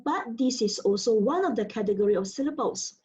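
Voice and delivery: ordinary talk, nothing marked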